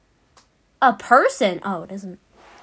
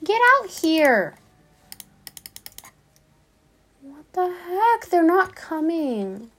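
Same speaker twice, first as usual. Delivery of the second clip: exasperated call; 6 semitones higher, slower than usual. Real, not acted. Calling friends who won't come.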